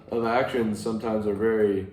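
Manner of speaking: usual speaking voice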